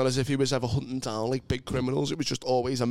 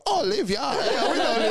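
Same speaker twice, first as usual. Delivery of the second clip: high, louder, laughing mock cop voice; mock local police; 11 semitones higher, 3 dB louder than usual. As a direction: lampoon the police, giggling through it. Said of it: "They go".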